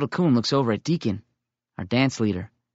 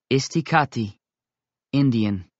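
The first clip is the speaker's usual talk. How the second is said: usual speaking voice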